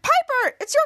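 high, loud summons; excited calling out. Retold or imitated. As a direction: act out calling someone over. Acted calling Piper to watch.

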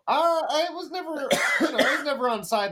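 high squeaky cartoon voice. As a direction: pitch it way up, thin and squeaky. Squeaky put-on voice reply.

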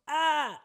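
high-pitched "ah" of mock anguish; mock wail. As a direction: wail briefly in mock sorrow. Mock lament after teacher's sad answer.

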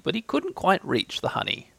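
usual speaking voice

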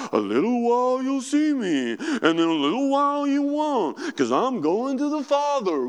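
high, mocking sing-song parody of Jesus; sarcastic. Disciples mocking Jesus's riddle to each other, sarcastic sing-song. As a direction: tease the teacher's riddle with sarcasm. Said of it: "I almost pick up a little bit of sarcasm".

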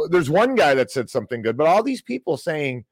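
usual speaking voice

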